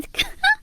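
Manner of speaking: high mocking laugh; a short derisive cackle. Sister-in-law ends her taunt with a high mocking laugh. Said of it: "웃어가면서 배를 잡더라고요".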